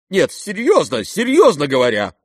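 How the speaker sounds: insist, groping for words; sharp exclamation. A narrator's reading. General presses the prince, sharply exclaiming. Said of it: "резко вскричал генерал".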